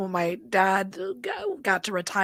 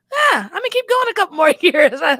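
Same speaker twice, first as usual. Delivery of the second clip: happy retiree; 8 semitones higher, 7 dB louder than usual. Retold or imitated. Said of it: "retirement time is like".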